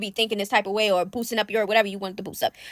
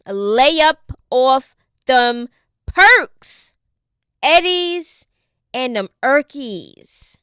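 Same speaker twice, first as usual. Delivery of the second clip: each word drawn out, much louder; mock stern warning; slow emphatic quote; 7 dB louder, much slower than usual. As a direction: spell out the warning slowly and loudly. Repeating the niece's warning.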